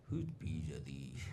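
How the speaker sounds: a quiet, weighty question from the guru; stern guru. Retold or imitated. Guru asks sternly about jar.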